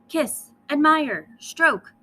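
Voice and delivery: loud, slow, high orders; Lily drilling the cousin in loud, slow commands; commanding. Acted. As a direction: bark each word as a royal order. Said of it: "Lily commanded".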